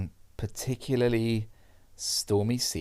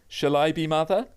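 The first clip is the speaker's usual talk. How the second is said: higher-pitched mock uncle, rising question; uncle's offer; 7 semitones higher, 4 dB louder than usual. Imitating an uncle with the gravy jug, playful raised pitch. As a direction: play an uncle offering to serve. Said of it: "your uncle picks up the gravy jug and says".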